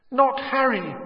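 high desperate plea from far away; first cry of a pleading mother, high. A narrator's reading. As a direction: beg for your child's life. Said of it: "his mother's voice was louder than ever".

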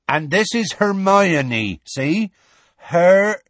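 loud, high, drawn-out introduction; Hagrid introducing. A narrator's reading. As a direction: spell it out loudly for a giant. Hagrid introduces Hermione to giant.

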